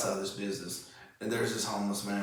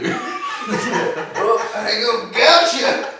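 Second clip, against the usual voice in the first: much higher and louder, laughing through it; mock homeless man; 14 semitones higher, 13 dB louder, much slower than usual. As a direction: ham up a panhandler brushing you off. Mocking a homeless man's reply.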